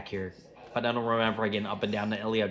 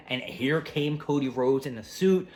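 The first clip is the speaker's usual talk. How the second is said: ordinary talk, nothing marked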